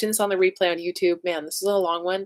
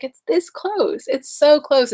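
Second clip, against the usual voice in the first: this pitched way up, slow and drawn out; excited emphasis; 8 semitones higher, 7 dB louder, much slower than usual. Real, not acted. Telling her viewers the farm is almost theirs, excited stress on this. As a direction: stretch out this close with rising excitement.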